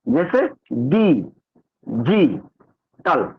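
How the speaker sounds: "डी, जी, टल" said slowly, syllable by syllable with pauses; slow syllable-by-syllable demo; split into syllables. Shown as a demonstration. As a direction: break "डिजिटल" into its three syllables "डी" "जी" "टल". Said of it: "इस तरह से break करते हैं".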